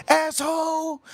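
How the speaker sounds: high, drawn-out mock yell; retold scream. Woman screaming at him as he gets back in, high mock scream. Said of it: "she screamed at me again".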